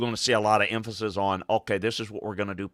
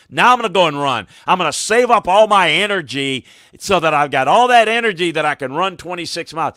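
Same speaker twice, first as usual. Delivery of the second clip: higher, much louder sarcastic boast; louder, higher sarcastic mock of the couch runner; 8 semitones higher, 9 dB louder than usual. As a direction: ham up the lazy runner's big plan. Mocking the couch-to-marathon runner.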